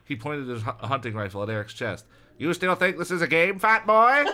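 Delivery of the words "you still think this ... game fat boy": high, loud menacing yell; hammed-up gunman's bellow; shouted threat. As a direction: build to a screamed taunt. Gunman threatens Eric at gunpoint.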